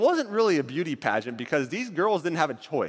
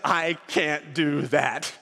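Esther refusing; 3 semitones lower, much slower than usual. Acted.

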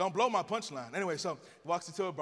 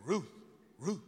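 talking dog. Acted. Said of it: "And the dog said".

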